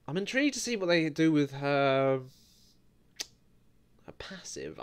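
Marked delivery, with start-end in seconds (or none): 4.1-4.8 whispered to himself. Real, not acted. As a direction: breathe "her passive" as a private aside. Whispered aside while wondering.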